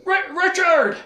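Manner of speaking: high-pitched mock distant call. Wizard calls to Richard, high mock holler. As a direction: imitate someone hollering for a friend. Imitating Zed calling out to Richard.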